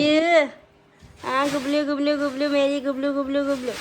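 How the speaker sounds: high, loud cooing. Real, not acted. Mother coos her baby's pet name, high loud baby talk. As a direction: coo the pet name in sing-song.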